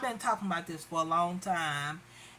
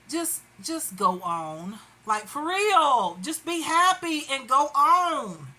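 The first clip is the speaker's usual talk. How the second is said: louder, higher, emphatic plea; raised-voice exasperation; exasperated urging; 4 semitones higher, 4 dB louder than usual. Retold or imitated. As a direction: urge them on, fed up. Exasperated appeal to the pursuer.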